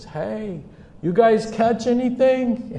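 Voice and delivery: high-pitched distant hail; calling out. Acted. Jesus on shore to the fishermen, calling out. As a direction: call across the water, voice lifted high.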